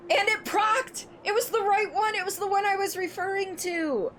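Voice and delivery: very high, thrilled squealing; high-pitched, giddy excitement; excited squeal. Real, not acted. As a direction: squeal it out in delight. Delighted the sound alert played.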